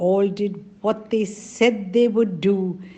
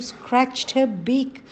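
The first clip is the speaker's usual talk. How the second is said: plain reading voice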